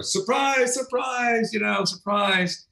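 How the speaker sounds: high, drawn-out singsong announcement; mock surprise reveal. Camera crew revealing prank to passenger, in high mock cheer. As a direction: imitate a crew springing the prank.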